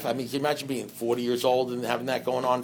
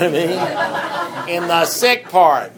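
slow and laughing, louder on the second line; joking about his own sick thinking, slow laugh; 3 semitones higher, 5 dB louder, much slower than usual. Real, not acted. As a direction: chuckle through the joke slowly.

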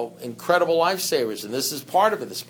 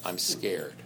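mock-timid, much softer and lower; softer, lower mock fear; small scared voice; 8 semitones lower, 10 dB quieter than usual. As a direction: shrink into a small frightened confession. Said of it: "That's alcohol code, isn't it? For".

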